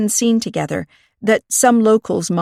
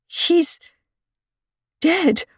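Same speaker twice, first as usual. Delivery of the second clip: forced out; 11 semitones higher than usual. A narrator's reading. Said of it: "pushed the next few words out with an effort".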